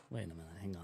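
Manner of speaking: hushed aside. Retold or imitated. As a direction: drop to a hush for the quote. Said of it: "I was like".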